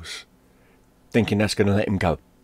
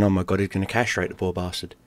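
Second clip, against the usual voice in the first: ordinary delivery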